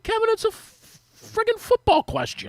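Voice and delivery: exasperated disbelief. Retold or imitated. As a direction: squeak out the protest in disbelief. Retold exasperated reply to Kevin.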